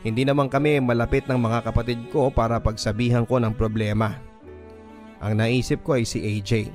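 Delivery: ordinary delivery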